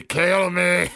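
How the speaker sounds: put-on creature plea, drawn out; lightly voiced miserable little monster; Gloom speaking. Drooling Gloom begging to be put down, put-on creature voice. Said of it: "if it could say anything".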